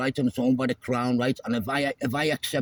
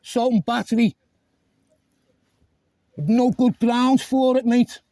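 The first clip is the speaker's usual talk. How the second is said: loud, higher, demanding to report a crime; shouting; 8 semitones higher, 4 dB louder than usual. Real, not acted. Driver shouting a complaint at the officer, loud and angry. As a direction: bellow the complaint at him. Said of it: "aggressive shouting at me".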